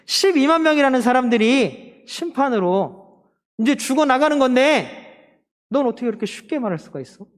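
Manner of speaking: high-pitched, impassioned scolding; God's rebuke. Acted. Preacher voices God challenging Jonah over Nineveh, impassioned rebuke. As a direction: confront someone with disbelief at their callousness. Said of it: "하나님 말씀하시는 거야".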